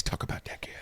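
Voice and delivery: hushed whisper into the ad. Acted. Host whispering to viewers before the sponsor read, hushed. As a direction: lean in and whisper it.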